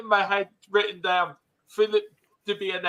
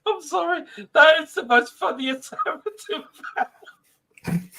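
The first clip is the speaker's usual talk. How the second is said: high, squeaky laughing voice; cracked up, words stretched by giggles; helpless laughter; 11 semitones higher, slower than usual. Real, not acted. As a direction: barely get it out through real laughter. Cracked up by Everton fan bit.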